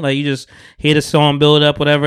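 ordinary talk

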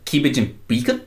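Kiwi vowels on cabbage and bacon; New Zealand accent. Shown as a demonstration. Answering the host's accent example, New Zealand accent. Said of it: "it's like saying cabbage and bacon here".

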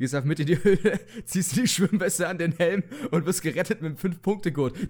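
words bubbling with laughter; can't stop giggling through it; laughing while talking. Real, not acted. Absurd cave rescue joke.

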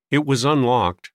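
usual speaking voice

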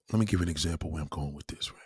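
hushed whisper to the viewers; quiet whispered aside before the story. Real, not acted. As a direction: drop to a whisper, confiding.

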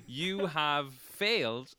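slow, gloating goblin voice. Acted. Game master voicing the goblin as it mocks the players, slow and smug. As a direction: draw it out like a smug little goblin. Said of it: "says the little goblin fella".

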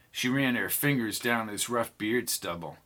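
ordinary delivery

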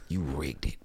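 unvoiced whisper of blame; whispered accusation. Brown whispering the accusation to the judge, hushed. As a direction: hiss it under your breath.

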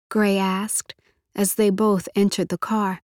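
ordinary delivery, nothing marked

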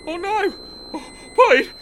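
high, loud panicked shrieks; mock panic. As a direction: shriek in hammed-up alarm. Helicopter mishap panic.

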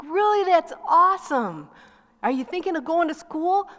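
high, delighted exclamation; light up at the good news; excited mom. Retold or imitated. Mom hearing her son's call to ministry, thrilled and high. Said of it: "I was like".

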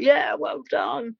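high, slow mock cheer of the crowd. Everyone at the summit cheers the runner, mock cheering.

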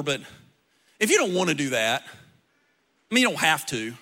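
low, fast, shrugging offhand tone; mock casual. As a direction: play it as a lazy, noncommittal shrug. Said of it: "He's never gonna say".

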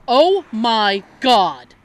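slow, loud mock horror; retold shock. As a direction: draw out each word in imitated shock. Boss reacts to exposure news.

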